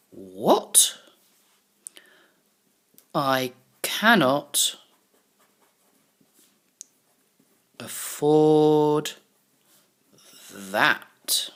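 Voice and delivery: drawn-out word by word while writing; slow dictation. Shown as a demonstration. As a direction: spell out the line slowly as you write it. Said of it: "this is what he's got to say".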